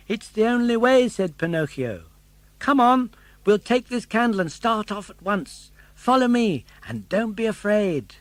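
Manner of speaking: put-on high eager puppet boy; bright young puppet voice; Pinocchio voice. Pinocchio's lines.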